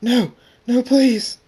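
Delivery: high, loud begging scream; terrified scream. A narrator's reading. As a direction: scream for mercy. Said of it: "Lightstorm screams".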